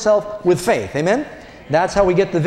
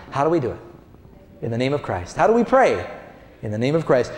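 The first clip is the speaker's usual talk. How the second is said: usual speaking voice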